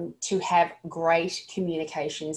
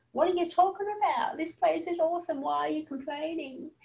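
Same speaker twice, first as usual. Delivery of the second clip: high, incredulous brush-off; the wrong way, mocking and dismissive; 9 semitones higher, 4 dB quieter than usual. Shown as a demonstration. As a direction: wave away the complaint with a scoff. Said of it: "Don't be like".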